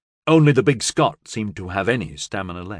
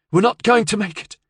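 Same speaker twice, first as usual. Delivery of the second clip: louder, insistent; 11 semitones higher, 4 dB louder than usual. Acted. Repeats to Mayo louder.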